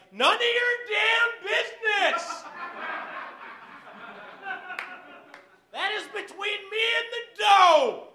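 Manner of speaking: high, slow, outraged squeal; indignant outburst. Retold or imitated. Snapping at the waitress over pizza toppings, high and outraged. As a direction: shriek it like an offended diva. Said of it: "I'm like".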